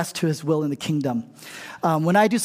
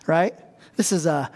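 ordinary talk, nothing marked

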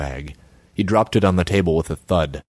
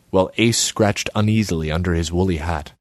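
ordinary delivery

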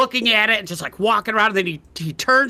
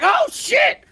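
high shouted alarm; shocked squeal. Acted. Tiny alchemist startled by the hero, high and shouting. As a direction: yelp as the beaker slips. Alchemist caught, drops beaker.

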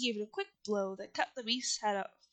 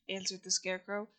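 usual speaking voice

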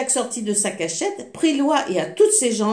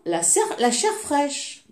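put-on ogre sniffing out a child; 6 semitones higher than usual. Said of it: "s'écria".

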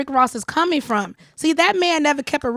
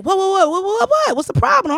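high, rapid-fire mock protest; startled protest; 8 semitones higher, 4 dB louder than usual. Retelling how the man reacted to her, startled mock protest. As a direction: imitate his taken-aback stammer.

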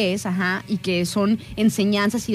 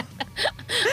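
risa aguda; 11 semitones higher, 5 dB quieter than usual. Real, not acted. Ríe tras el refrán.

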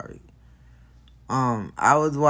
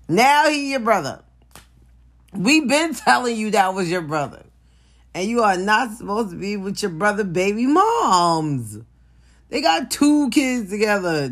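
exasperated rant; 7 semitones higher than usual. Real, not acted. Ranting at Fizz about his bandmate's baby mom, exasperated and higher. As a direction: lay into him, fed up.

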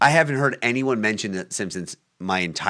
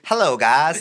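higher, louder mock Southern accent; Southern preacher impression; 8 semitones higher, 7 dB louder, slower than usual. Imagining the preacher greeting the guys next Thursday, mock Southern accent. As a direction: put on a thick Southern twang. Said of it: "he has, like, a southern accent".

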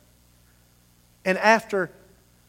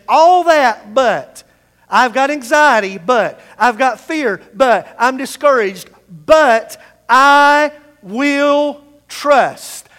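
louder, ending in a very slow drawn-out "but I will trust"; building resolve; 2 semitones higher, 7 dB louder, slower than usual. Acted. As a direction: build to a slow defiant vow. Voicing David, building to resolve.

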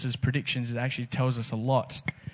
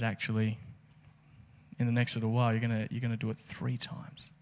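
much softer, grave and steady; quiet firm correction; 3 semitones lower, 10 dB quieter than usual. Retold or imitated. Jesus softly correcting boastful Peter. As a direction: drop the voice and say it eye to eye.

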